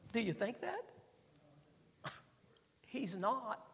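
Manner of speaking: ordinary talk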